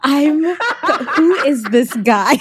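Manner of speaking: loud, slow, laughing who is this guy; cracking up in shocked disbelief. Real, not acted. Co-host hearing the spitting story, loud laughing disbelief.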